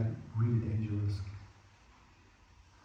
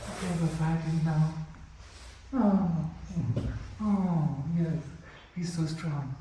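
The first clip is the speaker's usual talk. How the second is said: higher, lightly pretended struggle; gentle mock fighting; 5 semitones higher, slower than usual. Shown as a demonstration. Fighter cuddling an opponent in play, mock-struggling. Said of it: "very soft stuff, like, even very close to cuddling".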